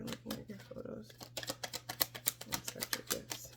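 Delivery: hushed, breathy whisper of the card names. Real, not acted. Reader channeling to herself, whispering the cards. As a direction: murmur it to yourself, almost no voice.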